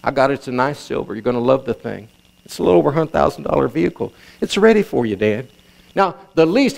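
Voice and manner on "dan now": ordinary talk, nothing marked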